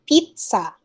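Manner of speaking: "Pizza" as PEET-sa; correct pronunciation. Shown as a demonstration. Teacher repeating the right form, peet-sa. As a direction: sound T then S in "Pizza". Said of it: "It's pizza".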